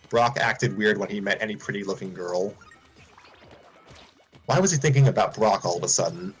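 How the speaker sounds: usual speaking voice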